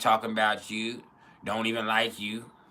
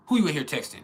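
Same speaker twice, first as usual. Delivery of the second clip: raised-pitch mock suspicion; pretend tripping; 6 semitones higher, 4 dB louder than usual. Boyfriend walking in on girlfriend texting, pretend tripping. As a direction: confront them as if you're tripping. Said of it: "act like you tripping".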